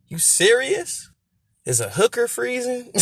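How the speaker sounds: high, drawn-out disbelief; mock-incredulous high question. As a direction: ask it high, as if it's absurd. Quotes the hater and the comeback.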